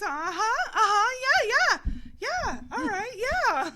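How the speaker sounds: high, loud eager uh-huhs, mimicking him; woman mocking a man cheering her on, high and loud; mock encouraging man. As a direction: ham up his eager cheering. Imitates man's thick-accent encouragement.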